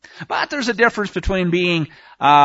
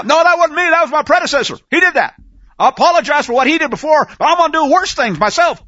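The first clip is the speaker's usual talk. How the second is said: higher, louder mock politician dodging blame; 9 semitones higher, 6 dB louder, faster than usual. Preacher mocking the Oval Office, hammed-up higher louder excuse. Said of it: "Like we get from the Oval Office now?".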